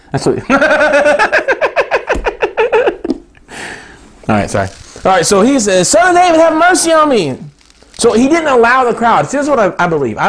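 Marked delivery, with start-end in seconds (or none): 0.4-3.2 long rising falsetto laugh; high laugh. Real, not acted. Preacher apologizing to the crowd for laughing, high laugh. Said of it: "I'm sorry for laughing". 5.9-7.4 a blind man calling out high and louder; crying out. Acted. Blind Bartimaeus calling to Jesus over the crowd, crying out.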